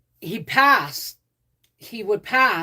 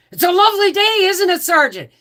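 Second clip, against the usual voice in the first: much higher, loud sing-song greeting; chirpy young private; 13 semitones higher, 9 dB louder than usual. Retold or imitated. Young private trying to charm his sergeant, high and chirpy. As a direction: lay on the cheer, pitched way up.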